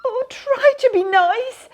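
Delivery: frightened lion pleading with the mouse; whimpering. Acted. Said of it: "he whimpered".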